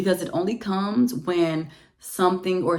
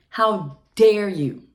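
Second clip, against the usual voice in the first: louder, slow, hit DARE; slow furious how dare you; 3 semitones higher, 5 dB louder, much slower than usual. Real, not acted. Confronting the ex again, slowly. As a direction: stress dare hard.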